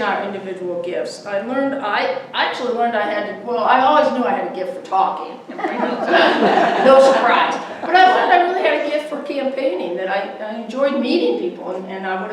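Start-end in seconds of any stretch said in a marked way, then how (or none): 5.5-6.8 high bright laugh. Real, not acted. Speaker laughs about her gift for talking, high. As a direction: laugh at your own joke.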